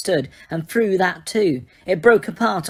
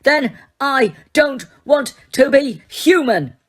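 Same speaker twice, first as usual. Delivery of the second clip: slow, louder, higher roar; 8 semitones higher, 5 dB louder, slower than usual. A narrator's reading. Harry raging at Dumbledore, slow roar. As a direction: drag it out in a furious roar. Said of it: "Harry roared".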